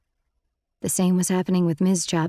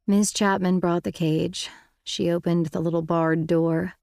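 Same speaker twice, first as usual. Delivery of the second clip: ordinary delivery, nothing marked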